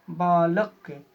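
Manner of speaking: "बालक" with extra force on the syllable "ल"; stress on ल. Shown as a demonstration. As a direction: lean on "ल" when saying "बालक", loudly. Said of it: "ल के पास ज़्यादा बल". ("बालक" में "ल" पर बलाघात)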